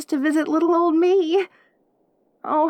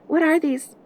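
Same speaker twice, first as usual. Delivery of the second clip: ordinary talk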